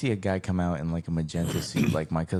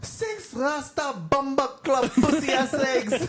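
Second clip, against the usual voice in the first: slow, high mock-outraged mother; hammed-up Jamaican outrage through laughter; Jamaican mom outburst. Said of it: "And his mom's like".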